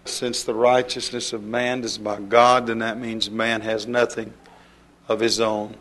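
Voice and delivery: ordinary talk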